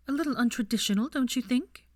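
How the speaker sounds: mother teasing her son; arch mother. A narrator's reading. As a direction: raise a sceptical brow in it. Narcissa questioning.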